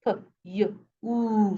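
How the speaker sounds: p, y, oo sounded one at a time; separate sounds. Shown as a demonstration. Teacher transcribing computer, p, y, oo sounded out separately. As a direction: isolate each sound, p then y then a long oo. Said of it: "we have the y sound in between".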